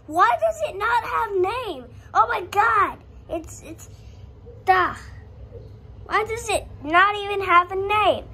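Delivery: exasperated yell. Real, not acted. Frustrated at game, yelling at screen.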